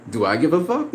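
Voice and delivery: ordinary delivery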